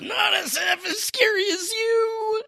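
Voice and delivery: very high, strained cartoon squawk; hammed-up indignant screech; shrieked retort. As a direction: fire back in a drawn-out mock screech. Voicing Summers's retort in manga.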